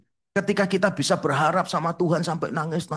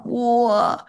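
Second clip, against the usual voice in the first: ordinary talk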